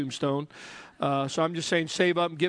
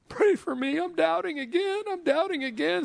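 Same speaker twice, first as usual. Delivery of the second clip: much higher, whining Thomas; whiny doubting Thomas; 12 semitones higher than usual. Acted. Thomas asking the group to pray for his doubt, high and whining. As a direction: plead like a fretful doubter. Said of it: "Thomas has to get in on it".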